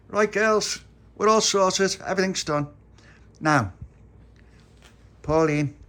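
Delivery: put-on loud, high call to the girls. Tony calls out proudly to the girls, loud and high. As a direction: announce it to the whole group.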